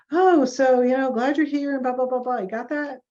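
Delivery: high, loud opening, rattled-off patter; glib mock spiel. Clinician reeling off information at a client, mock glib. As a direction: imitate a breezy clinician on autopilot.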